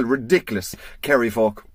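normal talking voice

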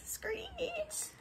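screech sound. Acted. Cable screeches.

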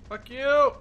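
usual speaking voice

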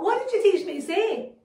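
ordinary talk

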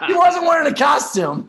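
high, loud laughing punchline; loud laughing payoff; laughing shout. Real, not acted.

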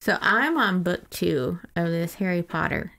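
normal talking voice

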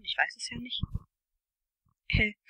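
ordinary delivery

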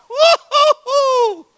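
high, slow joyful whoop; whooping cry. Real, not acted. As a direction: let out a long excited woo-hoo. Preacher whoops at seventh go.

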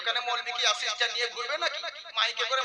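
ordinary talk, nothing marked

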